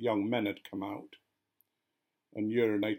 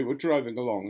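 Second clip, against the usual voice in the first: usual speaking voice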